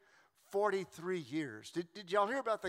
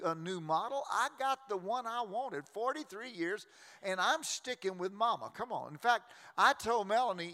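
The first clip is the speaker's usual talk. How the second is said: ordinary delivery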